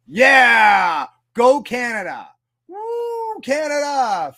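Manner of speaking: loud, high whooping hockey cheer; mock fan cheer. Streamer mocks a hockey fan celebrating a goal, whooping.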